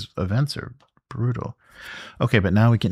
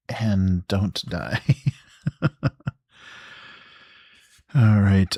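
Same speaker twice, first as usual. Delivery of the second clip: ordinary delivery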